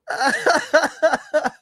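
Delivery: high-pitched helpless laughter; laughing fit. Real, not acted. Streamer unable to stop laughing, high giggles. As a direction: let the laugh spill out.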